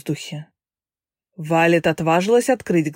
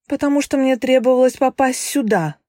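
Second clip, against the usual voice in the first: put-on bold young woman; 7 semitones higher than usual. A narrator's reading. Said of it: "смело ответила девушка".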